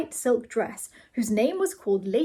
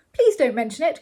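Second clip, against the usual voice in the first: louder, hearty exclamation; crying out; 4 semitones higher, 6 dB louder than usual. A narrator's reading. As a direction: call out politely, slightly higher. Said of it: "cried the Earl".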